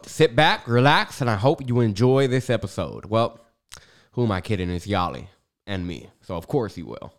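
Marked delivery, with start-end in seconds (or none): none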